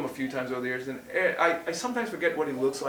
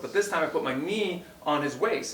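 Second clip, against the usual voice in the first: usual speaking voice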